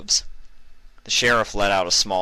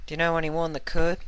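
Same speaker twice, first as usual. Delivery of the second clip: put-on high young mare voice; 11 semitones higher than usual. Applejack voiced in story.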